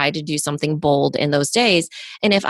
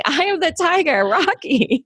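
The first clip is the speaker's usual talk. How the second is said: excited recognition; 10 semitones higher than usual. Retold or imitated. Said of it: "the rest of the world that's my age is thinking".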